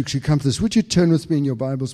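normal talking voice

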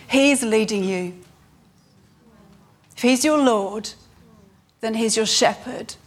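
normal talking voice

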